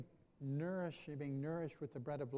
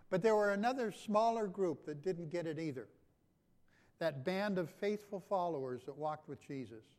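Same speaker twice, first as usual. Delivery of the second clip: louder, higher, driving sermon delivery; full-force preaching; 4 semitones higher, 7 dB louder than usual. Real, not acted. Pastor rousing the congregation about grumbling, loud preaching. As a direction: build the fire of the sermon.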